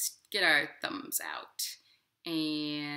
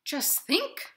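a lightly voiced robin, a touch higher; 10 semitones higher, 5 dB louder than usual. Robin questioning the inchworm, lightly voiced and skeptical. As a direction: skeptical bird. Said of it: "said the robin".

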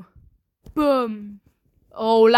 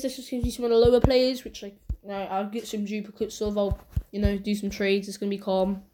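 ordinary delivery

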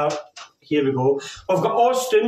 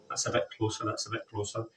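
muttered aside; 5 semitones lower, 12 dB quieter, faster than usual. Real, not acted. Aside to himself.

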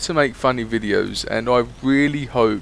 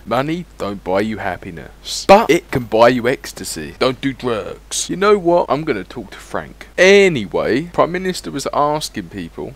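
usual speaking voice

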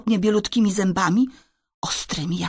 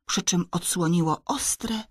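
ordinary delivery, nothing marked

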